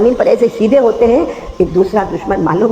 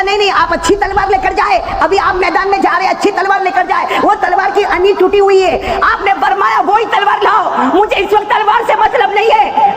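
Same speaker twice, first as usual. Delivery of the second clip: preaching at full force; 12 semitones higher than usual. Acted. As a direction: cry it out to the crowd.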